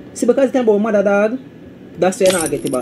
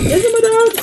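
much higher pitch, pushing the question at chat; high emphatic question. Real, not acted. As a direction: pitch "You see me, dog?" way up for emphasis.

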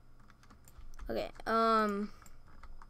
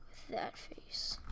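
soft unvoiced whisper; 17 dB quieter, slower than usual. Shown as a demonstration. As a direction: breathe it as a whisper. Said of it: "whispered repeat".

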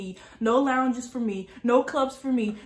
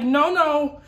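panicked no; 5 semitones higher, 6 dB louder than usual. Retold or imitated. Ankle cracks, starts crying.

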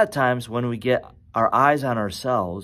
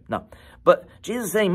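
normal talking voice

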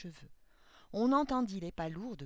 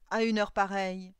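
loud disbelieving question; indignant old man; 3 semitones higher, 12 dB louder than usual. A narrator's reading. Old clockmaker to a late visitor, loud indignant question. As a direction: demand it loudly, affronted.

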